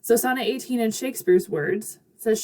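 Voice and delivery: ordinary talk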